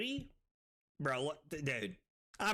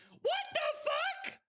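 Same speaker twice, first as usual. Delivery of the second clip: much higher, startled yell; shocked shout; 3 dB louder than usual. Real, not acted. Streamer startled mid-stream, shocked shout. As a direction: blurt it out in alarm.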